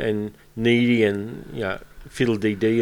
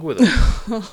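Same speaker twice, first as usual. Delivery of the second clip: Wicked Witch voice; 10 semitones higher, 4 dB louder than usual. Real, not acted. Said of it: "You sound like the Wicked Witch".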